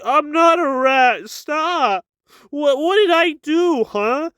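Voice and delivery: high-pitched mock protesting; indignant protest. [name] answering the guys who call him rat, mock indignant. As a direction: play up the wronged, defensive whine.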